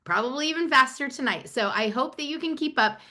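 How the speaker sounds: high-pitched, eager rush of enthusiasm; excited. Real, not acted. Presenter telling viewers she talks fast when excited, excitedly. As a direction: lift the pitch with real excitement. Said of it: "because I'm so excited. I talk fast".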